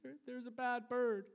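put-on high little-boy voice, insisting; Joshua. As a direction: imitate a small child's frightened report. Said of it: "And it was Joshua".

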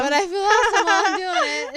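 loud laughing insistence. Real, not acted. Laughing, louder self-justification.

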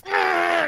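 high, strained rage roar; Hulk-out scream. Retold or imitated. Man losing it over a stuck chair, Hulk-out scream. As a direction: roar like someone hulking out. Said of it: "I hulked".

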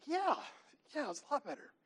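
high, overdone praise; mock-eager dad. Dad faking enthusiasm to his little daughter, mock gushing. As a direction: gush it like a distracted dad. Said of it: "It's like".

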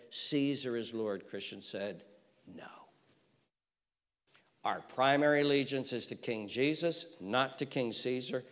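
solemn quiet testimony; hushed solemn preaching. Acted. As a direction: drop to a grave near-hush. Sudden hushed turn in sermon.

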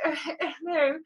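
high, prim phone greeting voice; answering a call in her polite phone voice; telephone voice. Retold or imitated. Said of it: "I have, like, a telephone voice".

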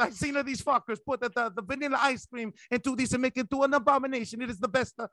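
high, mock-angry fake Italian accent; muddled fake Italian accent. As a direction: rant in a garbled, hammed-up Italian accent. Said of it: "I don't even know what accent that was".

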